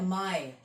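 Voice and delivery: माय said as one gliding syllable, rhyming with "my"; German माय, one open "ai" glide; German pronunciation. Shown as a demonstration. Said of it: "German में कहते हैं".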